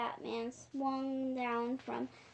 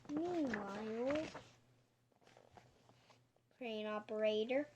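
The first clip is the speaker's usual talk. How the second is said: usual speaking voice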